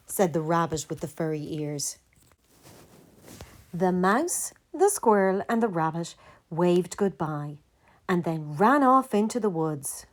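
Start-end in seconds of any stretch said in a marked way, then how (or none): none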